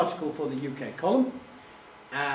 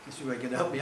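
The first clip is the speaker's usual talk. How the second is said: ordinary talk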